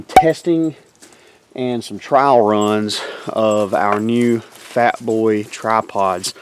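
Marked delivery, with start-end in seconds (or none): none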